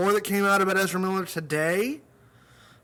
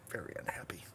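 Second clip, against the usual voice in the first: very soft, low and hushed; hushed understatement; 10 semitones lower, 18 dB quieter than usual. Real, not acted. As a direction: drop to a near-whisper. Understated aside on bad reviews.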